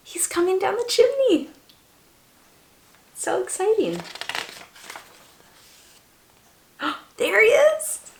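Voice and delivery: high, eager wonder for the little ones; excited. Pretend. Reader telling children Santa comes down the chimney, high and thrilled. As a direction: build delight as Santa arrives.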